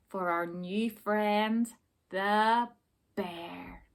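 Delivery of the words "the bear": whispered ending. A narrator's reading. Story ending softly.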